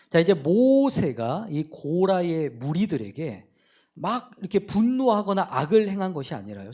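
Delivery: normal talking voice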